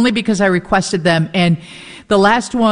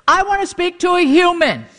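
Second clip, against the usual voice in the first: impatient demand; 10 semitones higher than usual. Retold or imitated. Demanding a human agent.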